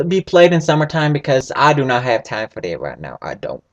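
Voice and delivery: normal talking voice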